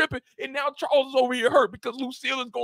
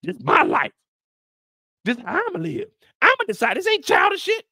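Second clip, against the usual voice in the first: shouting it loud and high; loud fired-up declaration; impassioned yell; 8 semitones higher, 5 dB louder than usual. Retold or imitated. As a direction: declare it like a heated quote.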